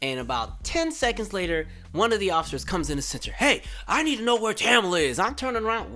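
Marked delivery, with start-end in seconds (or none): 3.3-5.2 higher, put-on demanding voice; imitated yelling officer. Officer demanding a person at the center, imitated yell. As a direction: demand it like a cop, raised voice. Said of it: "you don't gotta yell".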